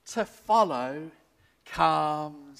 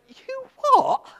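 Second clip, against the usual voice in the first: sharp, high disbelieving yelp; incredulous you what; 9 dB louder than usual. Retold or imitated. Stranger at the bar shocked by the minister, high yelp. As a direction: throw it back in disbelief.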